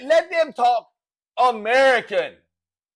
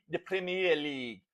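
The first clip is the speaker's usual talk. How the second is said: softer, lower attempt at an English accent; 7 semitones lower, 12 dB quieter than usual. Shown as a demonstration. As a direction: try a British pronunciation, low and quiet. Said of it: "they talk with that English accent".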